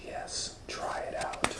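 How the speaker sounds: conspiratorial whisper. Acted. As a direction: breathe it sneakily. Mrs Tweezers whispering scheme.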